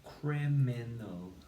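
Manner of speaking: «Criminal» said very slowly, syllable by syllable; slow dictation. Shown as a demonstration. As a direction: stretch «Criminal» slowly for spelling. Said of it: "and the word is".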